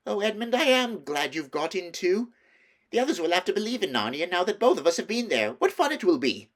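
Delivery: high, loud and quick; gleeful and eager; Lucy gushing with delight. A narrator's reading.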